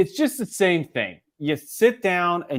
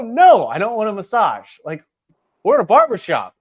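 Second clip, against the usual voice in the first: indignant retold refusal; 3 semitones higher, 6 dB louder than usual. Customer retelling turning down the barber's massage, indignant. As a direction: act out an emphatic, affronted no.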